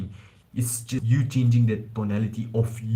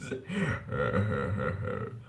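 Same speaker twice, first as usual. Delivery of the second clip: laugh; 6 dB quieter than usual. Real, not acted. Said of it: "that sounded weird".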